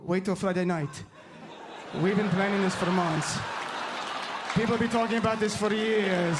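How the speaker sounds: ominous, heavy Middle Eastern accent. Shown as a demonstration. Comedian shows accent changes meaning, menacing Middle Eastern accent. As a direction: demonstrate how menacing a Middle Eastern accent can make it. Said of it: "with or without a Middle Eastern accent".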